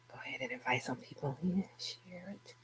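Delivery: fast whispered aside; whispering. Real, not acted. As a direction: mutter it while busy. Working the live feed aside.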